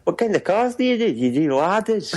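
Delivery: high, mock Scottish accent. Co-host as visitor asks about cars, Scottish accent. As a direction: ask brightly in put-on Scots. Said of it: "I can do Scots".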